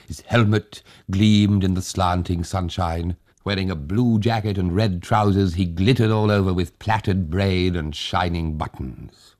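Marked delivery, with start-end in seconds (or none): none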